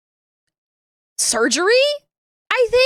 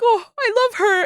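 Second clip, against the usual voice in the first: much higher, sighing Kermit voice; Kermit lovestruck; 10 semitones higher than usual. Retold or imitated. Gushing to chat about Kermit, high smitten frog voice. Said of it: "And then he's like".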